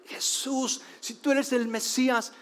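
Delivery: high desperate call; crying out. A narrator's reading. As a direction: keep shouting after Jesus. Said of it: "esta mujer siguió gritando". (grito desesperado y agudo)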